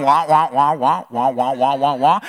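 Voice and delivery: droning wah wah nonsense; mock teacher wah-wah. Supervisor talking, heard as muffled wah-wah drone. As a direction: drone wah like an unintelligible adult. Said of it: "Does it sound like they're going".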